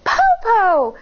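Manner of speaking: put-on high little girl calling; high child voice; child's voice. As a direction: call out like a small child. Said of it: "Shang said".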